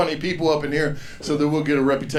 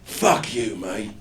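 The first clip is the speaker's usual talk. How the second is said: put-on slow, insulting Cockney accent; Jason Statham; slower than usual. Inner voice rejecting him, mean mock Cockney. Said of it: "It actually is Jason Statham".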